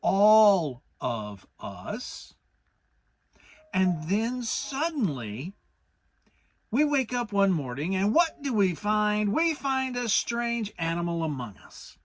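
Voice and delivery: a fussy rabbit laying out a grave problem slowly; slow and careful. A narrator's reading. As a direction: spell it out very carefully. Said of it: "very slowly and carefully".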